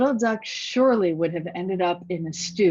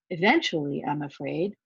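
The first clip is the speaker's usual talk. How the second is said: ordinary delivery, nothing marked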